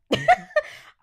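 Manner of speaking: high, loud real laugh; sudden giggle fit; burst of laughter. Real, not acted.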